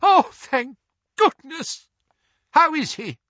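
tearful mother's relief; sobbing relief. Acted. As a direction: gasp it out through sobs. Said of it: "sobbed Missus Weasley".

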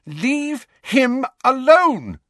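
high, loud and slow, a furious cry; Lily yelling to make the boys stop; shouted. A narrator's reading. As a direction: shout her down to stop them. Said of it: "Lily shouted".

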